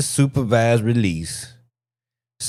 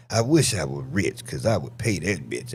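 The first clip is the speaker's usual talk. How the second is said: normal talking voice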